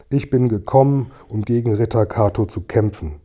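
usual speaking voice

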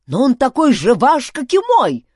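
loud, high protest; heated insistence; insistent. A narrator's reading. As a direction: argue your claim, voice climbing at the end. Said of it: "настаивал Дункан".

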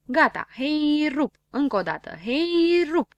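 loud, high heave-ho; heave-ho call. Acted. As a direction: call the push as they strain. Pushing the car together. (strigăt de opinteală, tare)

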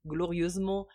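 ordinary delivery

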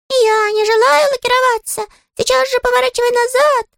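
very high, huffy little voice; a tiny critter putting its foot down; indignant squeaky creature. Acted. Щепкин's indignant voice.

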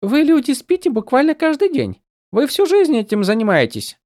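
high, light and teasing; a tiny sprite needling her friend; playful spren voice. A narrator's reading. Голос Сил. (высокий игривый голос духа)

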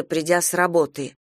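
usual speaking voice

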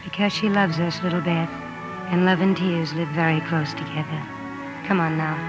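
low, soft comforting voice; hushed and tender, pitched low; tender reassurance. Acted. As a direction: gently soothe a little sister at night. Older sister comforts Beth at night.

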